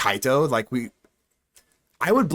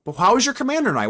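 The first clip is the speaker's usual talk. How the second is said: upbeat question; 9 semitones higher, faster than usual. Real, not acted. High fast question to chat.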